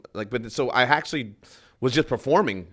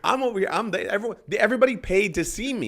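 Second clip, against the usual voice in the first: indignant scolding; 9 semitones higher, 6 dB louder, faster than usual. Retold or imitated. Comedian retells scolding the table.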